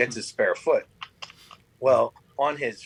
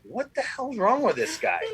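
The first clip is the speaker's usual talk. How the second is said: mock bewilderment; 10 semitones higher than usual. Onlookers staring, acted in story.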